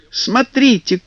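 high, bright excited shout; excited cry. Acted. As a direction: call out with delight. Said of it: "закричала она".

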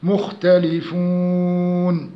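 very slow مختلفون, oo held at length; level chant with مختلفون drawn right out; longest madd. Shown as a demonstration. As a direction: stretch the final oo of مختلفون fully. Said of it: "ستة".

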